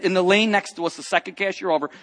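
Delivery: usual speaking voice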